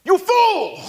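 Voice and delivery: high, loud theatrical outcry; Severus. Acted. Villain rebukes his startled halfling henchman, high mock-furious shout. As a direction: cry it out in hammed-up outrage. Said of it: "Severus is like".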